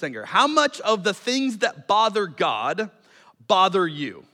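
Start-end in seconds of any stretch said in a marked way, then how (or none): none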